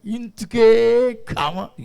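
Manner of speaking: stretched-out whiny plea, very slow; hammed-up crying prayer, mocking a churchgoer; mock wailing prayer. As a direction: drag every syllable like a self-pitying beggar. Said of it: "ఆడి slogan అన్నమాట".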